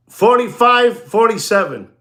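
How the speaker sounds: ordinary delivery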